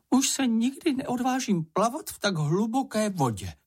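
high-pitched little creature, mildly horrified; a touch of dread in a high voice; horrified Čenich. A narrator's reading. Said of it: "řekl Čenich a otřásl se hrůzou". (vyděšený vysoký hlásek)

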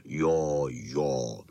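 very low, drawn-out rumble; Bernardin, big dog. A narrator's reading. Bernardin agrees with the pack, deep slow voice. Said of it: "říkal Bernardin".

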